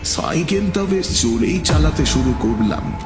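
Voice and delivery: ordinary delivery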